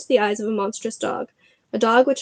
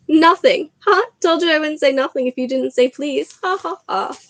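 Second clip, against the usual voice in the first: loud teasing gotcha, then high laugh; mocking poltergeist; 6 semitones higher, 3 dB louder than usual. Peeves fooling Filch, gleeful mocking cackle.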